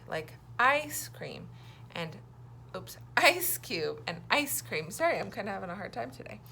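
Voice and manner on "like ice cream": "ice" stretched slowly with a long i; teacher showing the long i sound. Shown as a demonstration. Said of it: "I also makes the sound I".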